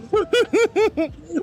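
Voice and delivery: bright high laugh burst; high giggle. Real, not acted. Laughing at chat.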